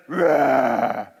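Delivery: a big, loud, drawn-out giant roar. Shown as a demonstration. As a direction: roar like a giant to show the crowd. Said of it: "So the giant goes".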